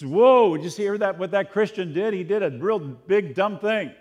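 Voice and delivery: loud, gasping mock outrage; a loud, hammed-up shocked bystander; mock gossip. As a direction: play the scandalised onlooker.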